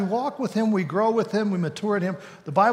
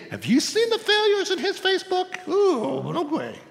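much higher put-on gossiping voice; mock scandalised Jesus; 13 semitones higher than usual. Jesus joking about another man's failures, high mock gossip. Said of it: "And Jesus says".

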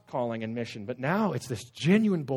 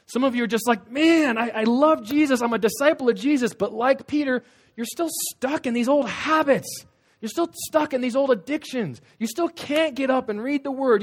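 high, loud preaching; fiery preaching; 8 semitones higher, 5 dB louder than usual. Acted. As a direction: drive it home to the congregation. Preaching at full force.

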